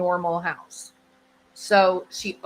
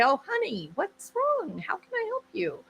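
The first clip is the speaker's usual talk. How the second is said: very high, soft coaxing voice; tiptoeing husband, softly pitched way up; placating husband; 10 semitones higher, 6 dB quieter than usual. Acted. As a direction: coax gently in a high, sweet pitch. Husband placating silent wife.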